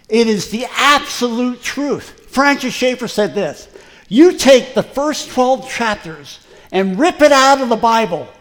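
loud, high preacher's proclamation; preaching at full force. Acted. Preacher declaring to the congregation, loud and fervent. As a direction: thunder it from the pulpit.

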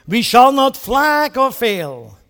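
rally a nation with each we shall; booming wartime resolve; Churchill quote. Retold or imitated. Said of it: "He goes on to say".

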